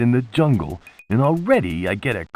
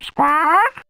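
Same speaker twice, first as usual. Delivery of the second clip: a high, playful squawk like a parrot; 4 dB louder than usual. Pretend. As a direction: squawk at the bird to coax it to talk. Mimics a parrot to greet it.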